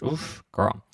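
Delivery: ordinary delivery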